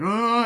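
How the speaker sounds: sharp high startled oh; high gasp. Retold or imitated. Boy gasps under the ride.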